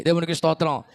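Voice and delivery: loud cry of praise to God; shouted praise. Acted. Pastor shouts praise to God mid-sermon, loud. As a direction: call it out to the hall.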